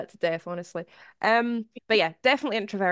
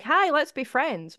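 high, drawn-out greeting to a stranger; mock friendly approach; 8 semitones higher, slower than usual. Terrified introvert imagining meeting a stranger, mock friendly. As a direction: overdo the let's-be-friends opener. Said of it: "going up to a random stranger".

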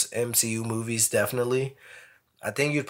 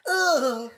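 overacted death scene; 14 semitones higher than usual. Mock dying groan.

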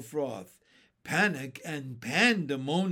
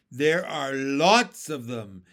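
slow, louder character voice; ladybug voice; 8 dB louder, slower than usual. A narrator's reading. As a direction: give the ladybug a distinct slow voice. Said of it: "said the ladybug".

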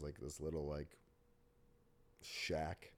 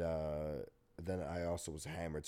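usual speaking voice

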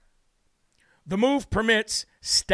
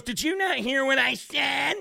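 higher, slow mock of a rude lady; rude screener; 5 semitones higher than usual. Retelling the airport screener talking down to him, high and slow. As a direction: mimic the screener snapping at him. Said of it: "She goes".

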